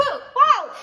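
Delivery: high, loud startled yelp with laugh; startled shout. Acted. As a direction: jump in fright, then laugh. Startled by a boo.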